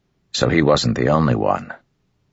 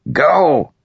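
sharp, high, loud shout; shouted command; 13 semitones higher, 5 dB louder than usual. A narrator's reading. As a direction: bark it out from outside. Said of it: "Gaz said from outside".

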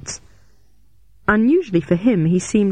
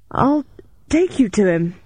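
higher, halting gasp; 5 semitones higher than usual. A narrator's reading. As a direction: break the words up for breath. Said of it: "I gasped".

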